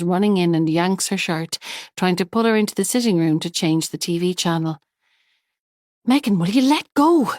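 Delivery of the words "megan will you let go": loud, high, exasperated shout. A narrator's reading. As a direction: snap it out at the child. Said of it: "Sylvia shouts".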